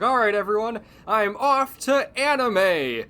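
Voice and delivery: loud, high, cheery farewell; storming off shouting. Pretend. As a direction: announce the exit with big excitement. S2 acts out Lucas leaving.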